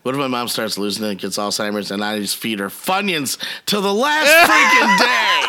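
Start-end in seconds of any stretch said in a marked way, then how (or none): 1.9-5.4 louder, slow and gleeful; building gleeful. Pretend. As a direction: savor the punchline. Imagining feeding mom Funyuns.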